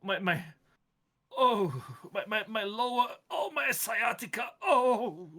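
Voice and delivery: put-on pained old groan. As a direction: moan it out like an aching back. GM voices plant in pain.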